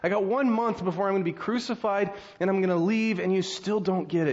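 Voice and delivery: mildly exasperated plea. Acted. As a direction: act out a teacher losing patience. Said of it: "he says the same kind of things".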